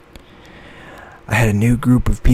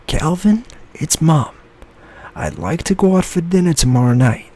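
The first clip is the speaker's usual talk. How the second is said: mom voice; 8 semitones higher, 4 dB louder than usual. Acted. Mom's voicemail message.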